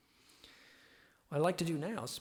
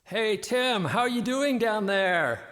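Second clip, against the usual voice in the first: high, loud call down a well; shouting far away; 9 semitones higher, 13 dB louder, slower than usual. Pretend. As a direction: yell down to him in the well. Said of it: "shout at each other from far away".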